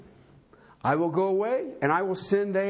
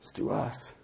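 whisper; 7 dB quieter than usual. Real, not acted. Hushed aside to congregation.

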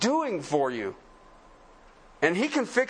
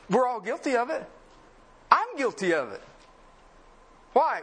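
ordinary delivery

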